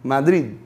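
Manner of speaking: Madrid, D barely touched at the end; correct Madrid. Shown as a demonstration. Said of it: "Não é Madrid, nem Madrid. Madrid".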